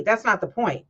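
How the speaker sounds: ordinary talk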